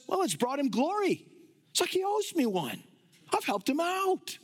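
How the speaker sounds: high-pitched mock objector, arguing his case. Objector arguing with Paul that his sin helps God, mock high-pitched protest. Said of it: "The person objecting to Paul's statements".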